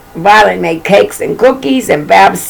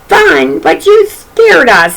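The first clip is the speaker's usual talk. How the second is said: put-on higher, slower little kids' protest; lightly voiced baby twins; 10 semitones higher, slower than usual. A narrator's reading. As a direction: squeak it out like small scared children. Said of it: "said the baby twins".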